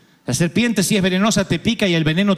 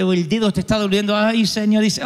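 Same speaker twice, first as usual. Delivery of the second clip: ordinary talk, nothing marked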